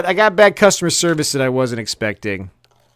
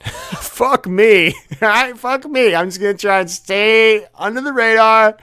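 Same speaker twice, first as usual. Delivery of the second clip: high, laughing fuck me; chuckling, raised pitch; laughing resignation; 6 semitones higher than usual. Real, not acted. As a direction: laugh it off, giving up.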